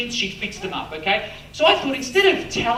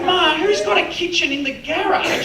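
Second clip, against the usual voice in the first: higher, disbelieving question; incredulous son; 9 semitones higher, 3 dB louder than usual. Retold or imitated. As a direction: ask it in disbelief. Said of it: "I said".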